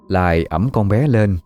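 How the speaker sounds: plain reading voice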